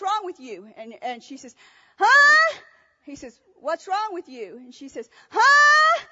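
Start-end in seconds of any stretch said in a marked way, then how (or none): 2.0-2.6 high, very loud "huh?" of someone hard of hearing; deaf woman's shout. Retold or imitated. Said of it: "he tells it just like that". 5.3-6.0 high, very loud "huh?" of someone hard of hearing; deaf woman's shout. Retold or imitated. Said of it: "he tells it just like that".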